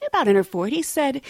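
usual speaking voice

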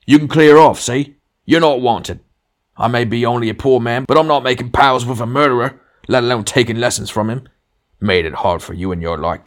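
high, loud blustering tirade; angry dismissal. A narrator's reading. Ghost scorning the murderer who worked under him, angry. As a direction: send him packing like a bullying boss.

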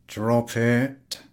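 frowning station master. Acted. Station master to Podyakin, frowning and curt. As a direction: cut him off firmly.

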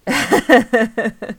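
loud, high rapid cackle; theatrical cackle. Pretend. Host teasing listeners about nightmares, loud cackle. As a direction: cackle with spooky Halloween glee. Theatrical laugh after spooky remark.

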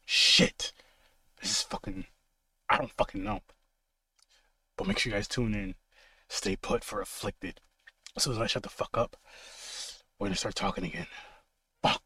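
low, hushed horror trailer narration; dramatic intro voice. Acted. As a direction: draw listeners in with a dark hush. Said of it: "I got a little intro for you guys".

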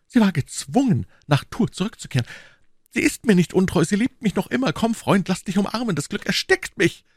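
high, rapturous outburst; giddy lover's exclamations; almost delirious. A narrator's reading. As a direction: pour out relief, overcome with happiness. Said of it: "rief Aramis fast wahnwitzig".

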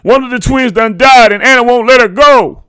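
high, very loud, frantic announcement; frantic shouting. A narrator's reading. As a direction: shout the terrible news down the phone. Father urgently phoning news of death.

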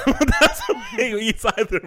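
slow, high, laughing through it; giggling through the words. Real, not acted. Laughing while making point.

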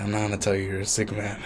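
soft, low retold refusal; quiet refusal. Imitating Craig refusing his drunk friend, quiet refusal.

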